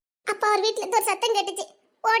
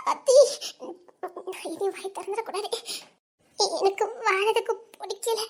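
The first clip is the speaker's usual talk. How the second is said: sobbing between broken phrases; despairing, catch the breath with sobs; weepy, halting despair; tearful. Acted. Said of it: "ஆ, சரி, இப்ப எப்படி அழுத".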